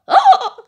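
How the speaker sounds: very high, loud panicked scream. Pretend. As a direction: let out a shrill panicked shriek. Character about to be voted out. (grito de pánico)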